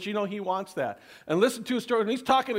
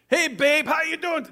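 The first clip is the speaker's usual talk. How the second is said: loud, higher, smarmy "Hey, babe"; cheesy pickup line; 7 semitones higher, 4 dB louder than usual. Shown as a demonstration. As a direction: ham up the cringey come-on. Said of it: "Make sure your tone of voice is".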